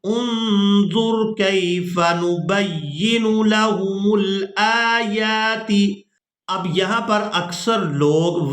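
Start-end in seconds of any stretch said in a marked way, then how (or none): 0.0-5.7 slow melodic tajweed recitation; Quran recitation. Shown as a demonstration. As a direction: intone it slowly, like a reciter.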